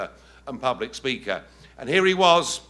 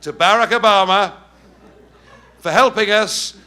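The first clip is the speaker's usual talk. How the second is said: louder and higher to the end, building; rousing shout; 6 semitones higher, 9 dB louder than usual. Real, not acted. The speaker thanks Obama to the crowd with a building rousing shout. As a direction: whip the room up into a sardonic cheer. Rousing finale to cheering room.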